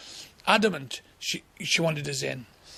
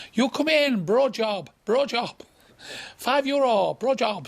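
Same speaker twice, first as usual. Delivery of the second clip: high, pushy broken-English pitch; touting madam; 6 semitones higher, 3 dB louder, slower than usual. Retold or imitated. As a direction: lure the customer in, loud and high.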